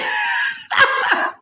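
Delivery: breathy shrieking laugh; high squealing laugh. Real, not acted. Host laughing at his own joke, high shrieking laugh. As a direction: let a high laugh burst out. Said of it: "I'm sorry I'm laughing".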